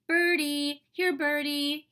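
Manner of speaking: put-on high, loud toddler calling to birds; little child's eager calling. A narrator's reading. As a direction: call the birdies over, pitched well up. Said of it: "he called".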